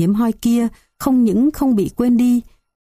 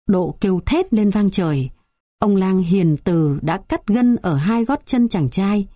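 ordinary delivery

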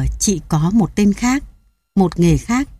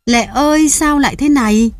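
anguished cry; 6 semitones higher than usual. Acted. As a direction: wail it up at the sky. Grieving man crying her name.

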